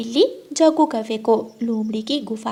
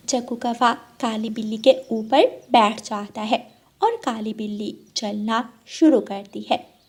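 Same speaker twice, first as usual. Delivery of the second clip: ordinary delivery, nothing marked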